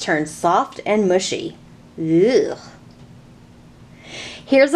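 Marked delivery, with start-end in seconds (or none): 2.0-2.6 disgusted ugh. Acted. Mushy sweet corn disgust.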